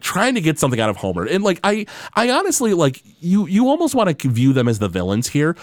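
usual speaking voice

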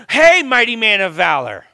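booming acted greeting; calling out. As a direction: hail him boldly across a distance. Voices God hailing Gideon.

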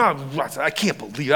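low, soft grumble; muttered disbelief. Acted. Disciples sent searching for food, muttered disbelief. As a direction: grumble it down low under your breath.

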